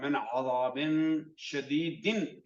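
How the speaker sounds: मिन अजाबिन शदीदिन drawn out, the final noon nasal; slow recitation with ghunna. Shown as a demonstration. Teacher recites the full phrase slowly for students, with nasal ghunna. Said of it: "आवाज को नाक में से निकालना है".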